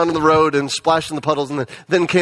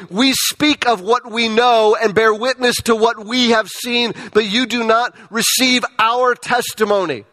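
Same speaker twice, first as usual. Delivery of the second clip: raised, solemn Bible reading voice; scripture reading; 4 semitones higher than usual. Acted. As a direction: read the verses aloud like proclaiming them. Reads scripture passage aloud.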